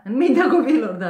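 loud, high, delighted laugh. Real, not acted. Reader laughing at the child's mind. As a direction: burst out laughing with joy.

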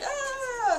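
high mock cry, breaking off; mock wail. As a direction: whimper it like a fake sob. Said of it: "I'm just kinda like".

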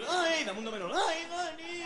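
lightly voiced smitten girlfriend; a hint of swooning girlfriend. Girlfriend quote continues. (voz de novia algo enamorada)

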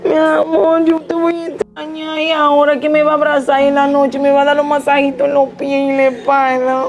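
a wife hammed up, begging her man not to forget her; clingy wife. Wife begging husband to call her from abroad, pleading.

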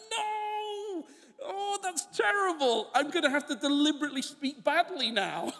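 high, hammed-up cry of horror that ends laughing; mock horrified Paul. Preacher imitating Paul to his congregation, hammed-up horror, high. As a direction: play Paul aghast at being too eloquent, laughing. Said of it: "And he's like".

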